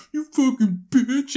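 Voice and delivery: high, slow mock kid curse; squeaky put-on voice of a crying little kid; kid cursing. Imitating the kid who got decked swearing at his girlfriend. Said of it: "He's like".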